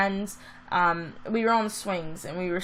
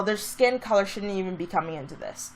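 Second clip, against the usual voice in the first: ordinary delivery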